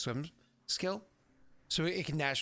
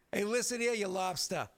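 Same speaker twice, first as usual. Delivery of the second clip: higher-pitched mock Boston lobster; Bostonian accent; 5 semitones higher than usual. Game master imitates the movie lobsters, mock Bostonian accent. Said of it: "with the Bostonian accent".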